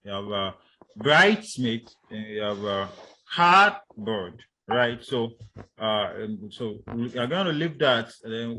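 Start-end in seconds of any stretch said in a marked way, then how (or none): 1.0-1.9 bridesmaid with BRIDES loud and long; stress on first word. Shown as a demonstration. Teacher listing compounds, bridesmaid stressed loudly on BRIDES. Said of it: "on the first syllable". 3.3-4.4 cardboard with CARD high and loud; stress on first word. Shown as a demonstration. Teacher listing compounds, cardboard stressed loudly on CARD. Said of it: "on the first syllable".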